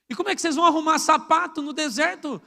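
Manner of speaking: normal talking voice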